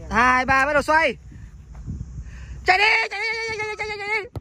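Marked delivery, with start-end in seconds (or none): 2.7-4.3 high yelled urging, over and over; excited shouting. Real, not acted. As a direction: call them to run, full voice. Urging players to run in game.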